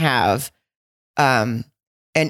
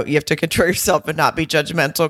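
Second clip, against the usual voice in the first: ordinary delivery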